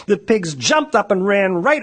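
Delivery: ordinary delivery, nothing marked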